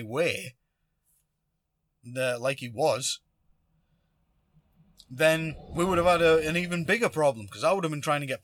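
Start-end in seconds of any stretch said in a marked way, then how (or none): none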